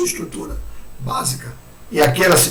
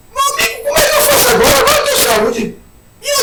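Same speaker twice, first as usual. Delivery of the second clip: high-pitched mock plea; acted dismay; much faster than usual. Healed man asking his friend for help, acted high-pitched dismay. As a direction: play someone suddenly at a loss, pitched up high. Acts out newly healthy man at a loss.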